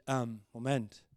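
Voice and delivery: low, soft muttered objection; quietly miffed, acted; puzzled inner voice. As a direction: play a sidelined hero thinking aloud. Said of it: "und denkt sich".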